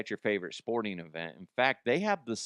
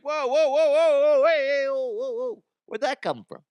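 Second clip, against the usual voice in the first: high, loud string of hammed-up New York whoas; mock New York accent; 8 dB louder than usual. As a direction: imitate a startled New Yorker, way over the top. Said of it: "New Yorkers are like".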